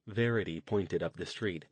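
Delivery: ordinary delivery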